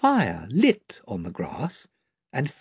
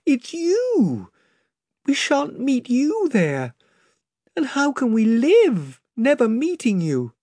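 sobbing; 12 semitones higher than usual. A narrator's reading. Lucy sobs to Aslan, tearful and higher. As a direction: let the sob catch in her voice. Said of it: "sobbed Lucy".

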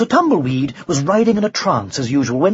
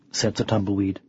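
ordinary delivery, nothing marked

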